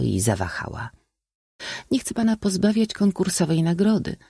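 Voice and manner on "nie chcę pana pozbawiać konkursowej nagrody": high, feminine voice; young lady. A narrator's reading. Young lady refusing the suitor's bouquet, high-voiced. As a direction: decline politely in a high voice.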